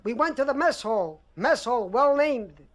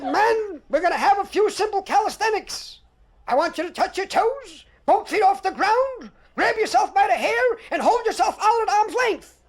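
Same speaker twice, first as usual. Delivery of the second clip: barking sergeant; 6 semitones higher than usual. Retold or imitated. Sergeant barking drill orders.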